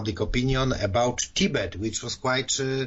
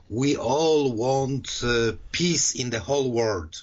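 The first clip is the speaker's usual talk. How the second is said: drawn-out, very slow quote; quoting a speech; 2 semitones higher, slower than usual. Retold or imitated. Guest quoting the Dalai Lama's speech on peace, slow quote. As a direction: stretch each word like a grand pronouncement. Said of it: "he was speaking, uh, things like".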